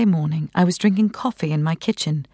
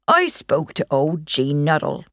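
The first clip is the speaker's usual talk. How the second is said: lightly voiced old country neighbour; a hint of a gossipy old moor man; old Dartmoor countryman. Old neighbour Bill passing on gossip, lightly voiced countryman.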